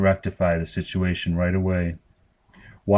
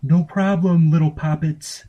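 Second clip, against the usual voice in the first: high, loud chirpy shop voice; sugary customer-service brightness; much higher, louder sweet cashier voice; cheery clerk; 9 semitones higher, 4 dB louder than usual. A narrator's reading. Cheerful store clerk replies.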